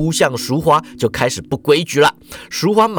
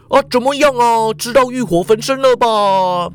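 higher, leering question; gloating lecherous boss; 6 semitones higher than usual. A narrator's reading. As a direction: crow over the victim. Ikeda gloats while groping. (色社长得意洋洋地挑逗)

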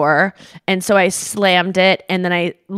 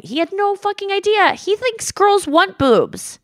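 mock exasperation; 13 semitones higher than usual. Mocking dad's cluelessness.

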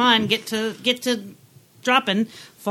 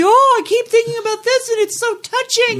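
much higher and louder, gushy fan voice; mocking gushing fans; 7 dB louder than usual. Mocking movie fans who gush over the film, in a high, loud gushing voice. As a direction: send up the swooning fans. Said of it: "like, everybody".

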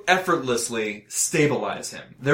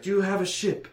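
injured man's question; 7 semitones higher than usual. Acted. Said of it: "he's like".

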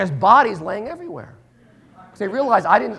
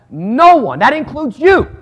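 emphatic preaching; 6 semitones higher, 8 dB louder, slower than usual. Real, not acted. Preacher insisting loudly.

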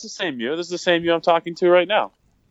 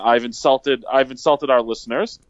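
ordinary delivery

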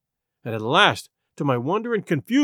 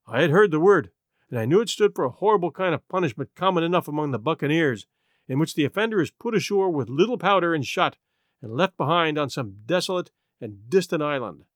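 ordinary delivery, nothing marked

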